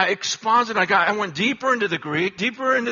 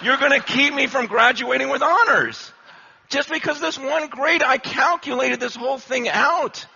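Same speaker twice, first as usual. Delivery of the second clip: higher and louder, pushing the case; indignant plea; 6 semitones higher, 5 dB louder than usual. Retold or imitated. Student pleads with professor over a grade, rising indignation. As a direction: argue your honors are at stake.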